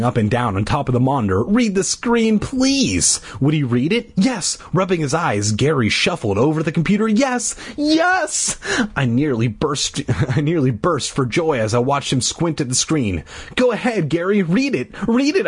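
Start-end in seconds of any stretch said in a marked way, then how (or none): 1.4-3.2 high pleading. A narrator's reading. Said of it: "I pleaded". 7.1-8.6 excited. A narrator's reading. Bee thrilled Gary approaches. 13.6-15.5 high squeaky urging; squeaking. A narrator's reading. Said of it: "I squeaked".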